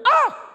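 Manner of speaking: short high-pitched loud scream; imitated scream heard on the ride; sudden scream. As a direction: let out a sudden piercing "Ah!". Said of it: "then he'd hear, he'd hear".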